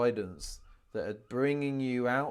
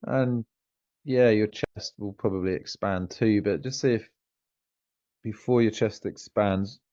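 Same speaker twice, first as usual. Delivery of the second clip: lower, relaxed meditation guide with long pauses; guided meditation; 4 semitones lower, 6 dB louder than usual. Real, not acted. Coach leading a client through a grounding meditation, guided meditation voice. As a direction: guide them down into the body, leaving room to feel.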